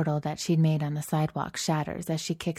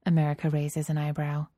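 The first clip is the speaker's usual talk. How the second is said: ordinary delivery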